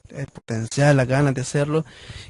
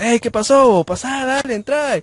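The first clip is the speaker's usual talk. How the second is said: imitating the uncle's cheerful greeting, much higher and louder; happy welcome; 11 semitones higher, 7 dB louder, slower than usual. Uncle warmly welcoming his shy nephew, high and cheerful. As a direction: pitch it up high like a delighted uncle waving you in. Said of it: "él bien contento me dice".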